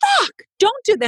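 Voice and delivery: high, exasperated outburst; retold startled anger. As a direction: imitate snapping at someone who just scared you. Acts out angry jump-scare reaction.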